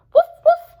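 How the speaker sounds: high, loud yappy woofs; squeaky puppy woof-woof; puppy bark. Acted. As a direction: bark like a happy little puppy. Puppy barks at spilled biscuits.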